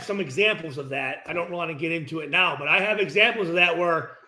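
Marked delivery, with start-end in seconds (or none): none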